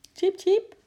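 very high, happy squeak; cheerful chirping. A narrator's reading. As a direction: chirp merrily to a friend. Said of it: "piepte hij vrolijk naar Sjimp". (vrolijk, hoog gepiep)

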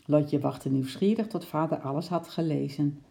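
ordinary delivery

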